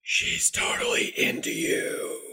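high, airy whisper to Joni; secret whisper. Pretend. Whispering a secret to Joni.

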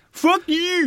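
high, loud LA-style yell; LA accent. Pretend. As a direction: bark it like an LA local. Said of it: "as I like it in L A".

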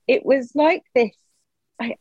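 ordinary talk

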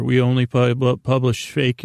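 ordinary talk

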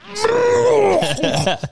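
high, shrieking burst of laughter; loud shrieking laugh. Real, not acted. Wild laugh in the story.

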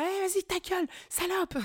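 imitated road-rage yell, very high-pitched; automobiliste vénère. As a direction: hurl a high mock insult from the car. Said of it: "des gens qui se vénèrent". (conducteur énervé imité, très aigu)

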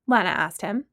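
usual speaking voice

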